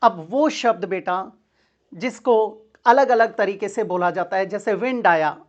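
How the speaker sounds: ordinary talk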